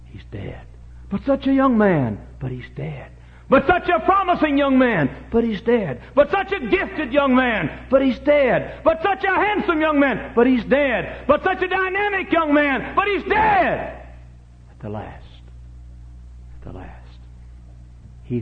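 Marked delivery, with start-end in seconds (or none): 1.1-2.2 high, loud lament; protesting cry. Acted. Preacher voicing protest over dead youth, high cry. Mourner's protest set against refrain. 3.5-14.1 high, loud cries building to a shout; impassioned lament. Acted. Preacher lamenting a promising young man dead, building shout. 14.7-17.2 low, very soft and solemn; hushed refrain. Real, not acted. Preacher after crying out over the dead, hushed.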